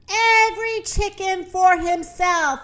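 high-pitched rooster's alarm call; lightly shouted panic warning; mild shout. Acted. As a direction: cry it out high, not at full volume. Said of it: "shouted the rooster".

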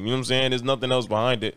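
usual speaking voice

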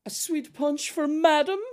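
very high, drawn-out waiter voice; waiter impression. Waiter serving a sweet punch to madam, high-pitched mock announcement. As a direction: exaggerate a waiter announcing a drink, high and loud. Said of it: "he comes over and he goes".

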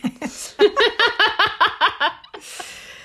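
long, high peal of laughter; high staccato belly laugh; big cackling laugh. Real, not acted. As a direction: crack up completely at the joke. Laughs at exhausting tapeworm joke.

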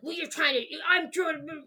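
mock an irritable dad's grumbling; grumbling angry dad. Daughter mimics her actual dad brushing off lunch, grumbling angrily. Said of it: "you know, grumble, grumble, angry, angry".

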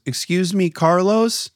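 put-on high, polite little girl calling out; lightly voiced small girl getting his attention. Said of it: "She goes".

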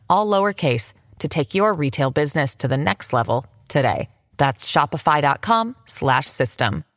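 ad read. Acted. Read ad spot.

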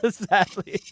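laughing nervously through the words; uneasy laugh while talking. Real, not acted. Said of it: "that's a nervous laugh".

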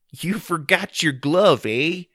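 slow, high, loud Canadian fellow; Canadian character. Acted. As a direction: drawl it like a folksy Canadian. Canadian character Barry speaks.

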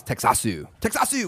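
"Texasu" with a final "u" added; Japanese pronunciation. Retold or imitated. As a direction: say "Texasu" as a Japanese loanword, then pitched high. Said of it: "I don't know what the, the word is".

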